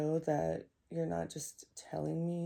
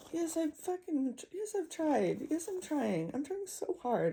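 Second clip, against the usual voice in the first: higher-pitched, frustrated retort; real pleading exasperation, voice pitched up; exasperated outburst; 7 semitones higher than usual. Real, not acted. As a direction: protest in desperate frustration, pitch raised. Exasperated answer to retold unwanted advice.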